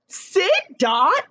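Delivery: high bossy call to a dog-like cat; change your voice for the quotation marks; quoted command voice. Shown as a demonstration. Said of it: "I had to change my voice".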